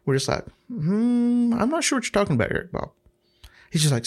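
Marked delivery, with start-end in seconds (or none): 0.7-2.9 higher, sing-song fake innocence; mock innocence. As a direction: play dumb, teasing the confused boss. Feigning innocence to the manager.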